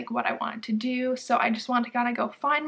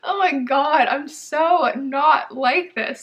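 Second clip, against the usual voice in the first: emotional; 3 semitones higher, 6 dB louder, slower than usual. Real, not acted. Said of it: "I have no clue why I'm getting emotional".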